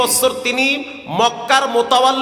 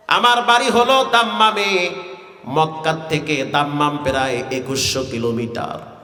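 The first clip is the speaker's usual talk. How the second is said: melodic waz preaching; 7 semitones lower than usual. Acted. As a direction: intone the life story on a low note. Tuneful sermon storytelling. (নিচু সুরে ওয়াজ)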